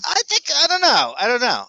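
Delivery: put-on high, loud Boston accent. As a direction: try out a thick Boston accent. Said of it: "With a Boston accent".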